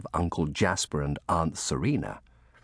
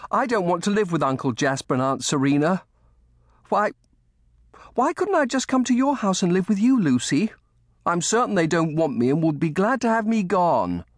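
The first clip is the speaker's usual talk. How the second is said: put-on high-pitched boy, pleading and upset; louder, higher child's outburst; blurted protest; 12 semitones higher, 5 dB louder than usual. A narrator's reading. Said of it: "Colly blurted at last".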